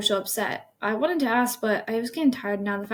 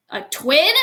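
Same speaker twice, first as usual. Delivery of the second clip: higher, louder shocked question; stunned, raised-voice question; nearly shouted; 4 semitones higher, 7 dB louder than usual. A narrator's reading. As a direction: blurt it out just short of a shout. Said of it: "I nearly shouted".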